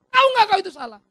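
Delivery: high, loud accusing question; stern confrontation. Acted. Pastor demands if the prisoner knows it's wrong, loud.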